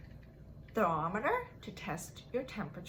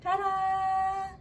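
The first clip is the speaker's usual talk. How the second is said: bright ta-da, higher and louder; showy game-show reveal; sing-song reveal; 10 semitones higher, 6 dB louder than usual. Acted. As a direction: present it like a magic trick.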